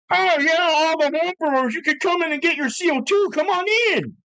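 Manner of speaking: high, gushing salesy voice ending in a call; mock website ad. Mocking the shop's website ad in a high gushing voice. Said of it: "they advertise it on their website like".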